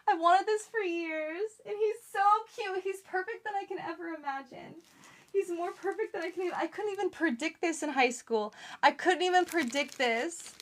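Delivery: high, gushing delight; excited gushing. Real, not acted. Vlogger raving to viewers about her robot, high and excited. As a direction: rave about how perfect it is. Gushing over new gadget.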